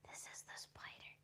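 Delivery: hushed whisper. Real, not acted. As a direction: whisper so the spider stays put. Shows spider on camera.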